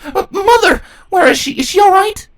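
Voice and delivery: frantic worry; alarmed cry. Acted. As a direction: blurt it out in fear for her mother. Twilight panics about mother.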